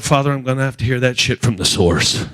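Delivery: ordinary talk, nothing marked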